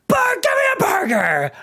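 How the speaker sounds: high-pitched screaming order; mock scream. Daphne slamming the menu down at the waiter, screaming. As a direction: shriek it like a diner losing it. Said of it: "slams the menu down and screams".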